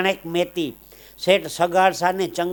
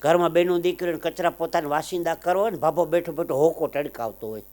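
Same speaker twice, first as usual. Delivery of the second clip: usual speaking voice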